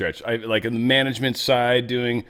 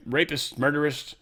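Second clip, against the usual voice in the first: ordinary delivery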